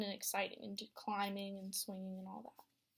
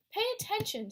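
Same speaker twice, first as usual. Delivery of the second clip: snapping teacher; 10 semitones higher, 6 dB louder than usual. Acted. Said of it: "snaps Maestro".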